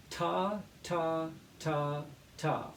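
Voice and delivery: ta, ta, ta, one per beat; teacher modelling "ta" for the class; rhythm syllables. Shown as a demonstration. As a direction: count "ta" evenly, one beat each. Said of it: "we're gonna say".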